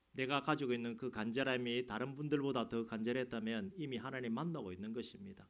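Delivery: ordinary delivery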